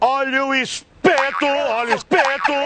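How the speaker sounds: loud, stretched hawker's cry; street vendor call. Acted. Skewer vendor calling out.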